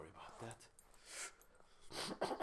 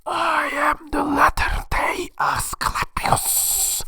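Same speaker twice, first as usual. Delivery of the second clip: high, slow, whispered alien rasp; whispery alien oracle voice; snake god voice; 14 semitones higher, 3 dB louder, much slower than usual. Acted. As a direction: intone it like an eerie otherworldly god. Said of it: "and the snake god says".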